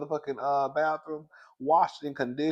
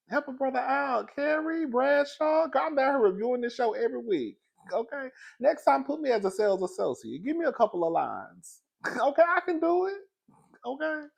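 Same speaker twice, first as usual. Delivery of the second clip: high, bright pleading to the producers; playful high plea; 11 semitones higher than usual. Real, not acted. Vlogger begging the show's creator for a role in a high voice. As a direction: beg in a raised, comic voice.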